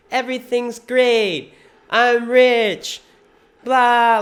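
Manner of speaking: high, loud, drawn-out mock cheer; mock positivity. Mocking self-help believers floating in the clouds, exaggerated cheer.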